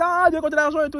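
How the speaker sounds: high, fast mock woman; lightly voiced woman echoing; woman repeating. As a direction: parrot it back in her voice. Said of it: "Elle dit".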